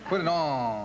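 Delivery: ON rounded like a Southerner's; mock Southern ON; imitated Southern accent. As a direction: mimic the Southern way of saying ON. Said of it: "In the South".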